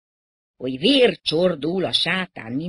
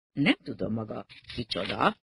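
ordinary delivery, nothing marked

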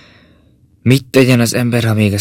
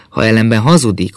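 usual speaking voice

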